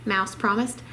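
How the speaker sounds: plain reading voice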